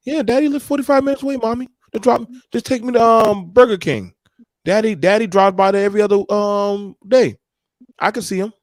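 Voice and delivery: put-on high, fast little kid begging mommy; child voice. Voicing herself as a small child.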